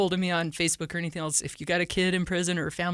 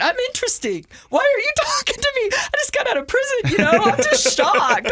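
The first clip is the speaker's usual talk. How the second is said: high, loud, laughing disbelief at the silent guys; mock incredulous; 7 dB louder than usual. Ex-prisoner on a plane retelling her inner astonishment, high and laughing. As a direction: act amazed that nobody wants to talk. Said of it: "I'm thinking".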